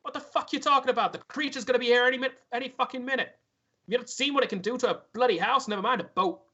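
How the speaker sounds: high-pitched, exasperated sweary rant; angry rebuke. Acted. Dwarf sailor to man refusing to row, angry. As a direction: argue back hard, incredulous and alarmed.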